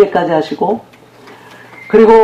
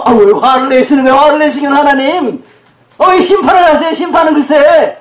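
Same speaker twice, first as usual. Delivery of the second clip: much higher, hammed-up complaint; mock indignant protest; 9 semitones higher, 3 dB louder than usual. People talking back to God about judgment, mock indignant. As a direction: protest in a high voice as if wronged.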